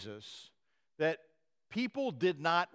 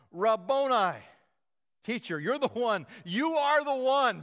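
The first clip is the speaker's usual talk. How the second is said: crying out; 6 semitones higher, 6 dB louder than usual. Retold or imitated. Mary recognizes Jesus and cries out joyfully. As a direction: call out in overjoyed recognition. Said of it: "she turned to him and cried out".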